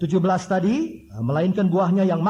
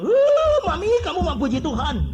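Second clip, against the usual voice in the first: high, loud mock snap at Mami; husband blurting out at his wife, half-laughing; mock exasperated outburst; 10 semitones higher, 6 dB louder than usual. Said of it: "maki-maki, kita bilang".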